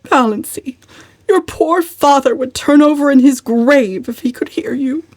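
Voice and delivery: high, reproachful mother voice; lightly voiced reproachful mother. A narrator's reading. Mother reproaching her daughter, lightly voiced and shocked. Mrs Frederick reproaches Valancy.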